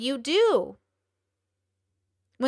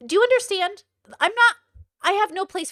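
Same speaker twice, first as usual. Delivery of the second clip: outraged outburst; 8 semitones higher, 4 dB louder than usual. Real, not acted.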